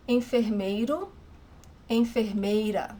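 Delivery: enfermeiro and enfermeira said as in-fer-MAY-ru, in-fer-MAY-ra; Portuguese pronunciation. Shown as a demonstration. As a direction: model both forms clearly.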